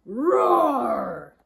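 loud, drawn-out storybook roar; dinosaur roar. Acted. Reader voices a huge dinosaur smashing in, loud roar. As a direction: let out a big, long roar.

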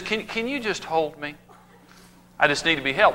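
mock tender wife asking softly; soft pleading. Wife softly asking husband to hold her.

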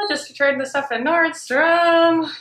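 muffled behind cloth mask; through a face mask. Real, not acted. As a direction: mask covering mouth and nose.

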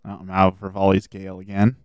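ordinary delivery

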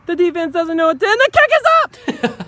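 excited play-by-play announcer; sports commentary. Pretend. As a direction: call it like a live game. Mock play-by-play of kids' game.

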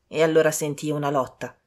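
ordinary delivery

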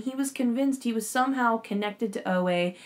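ordinary talk, nothing marked

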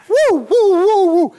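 hammed-up party cheer; party whoop. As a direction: whoop like the party has started. Prank caller whoops in celebration.

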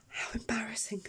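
whispered, sheepish aside; real embarrassed whisper. Real, not acted. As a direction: hush it out as if embarrassed. Embarrassed after tearing up on camera.

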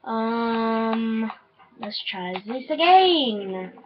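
usual speaking voice

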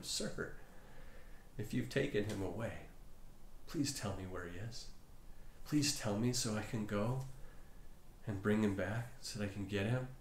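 soft, hushed, tearful pleading; a hint of sobbing, softly begging; tearful plea. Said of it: "sobbing convulsively".

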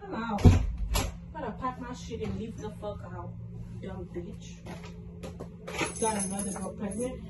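low, very soft angry muttering; quiet, seething aside; bitter grumble. Real, not acted. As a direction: mutter it to yourself while packing. Grumbling to self while leaving.

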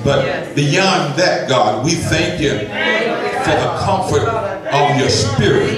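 fervent preaching prayer. Acted. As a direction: build the prayer into a full church shout.

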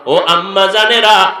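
usual speaking voice

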